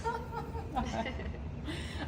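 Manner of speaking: quiet, breath-light chuckle; small amused laugh; soft giggle. Real, not acted. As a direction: giggle softly at yourself. Laughing at her onion tears.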